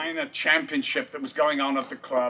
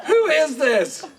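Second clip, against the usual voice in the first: high, loud "Who is this?"; 11 semitones higher, 5 dB louder than usual. Retold or imitated. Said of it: "She goes".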